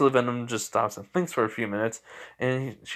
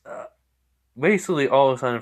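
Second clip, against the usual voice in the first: usual speaking voice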